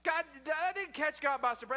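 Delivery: ordinary delivery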